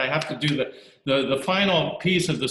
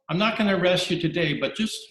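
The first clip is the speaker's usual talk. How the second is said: relenting officer; 7 semitones higher than usual. Acted. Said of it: "the British guy says".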